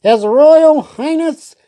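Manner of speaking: high, slow announcing herald; herald's cry. Acted. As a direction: trumpet the prince's arrival. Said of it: "he cried".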